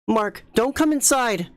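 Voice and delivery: high, panicked shout to warn him; frantic yell. Acted. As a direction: yell a warning, terrified. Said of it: "Rachel yelled".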